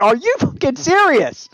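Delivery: high, drawn-out retold outrage; pissed-off disbelief. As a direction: act out an exasperated, incredulous challenge. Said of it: "I'm getting so pissed off".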